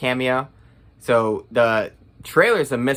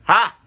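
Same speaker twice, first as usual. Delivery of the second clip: mock laugh; 8 semitones higher, 6 dB louder than usual. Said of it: "and I was like".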